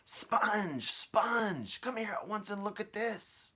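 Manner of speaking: high, loud, bossy aunt calling; shrill aunt voice. Acted. Aunt calling her sister to the tree, high and loud. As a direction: screech it out like a nasty aunt.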